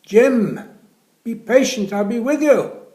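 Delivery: loud, high, slowed call from afar; nurse shouting back. Acted. Nurse shouting to Jim from down the corridor, loud and high. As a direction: project it down the corridor.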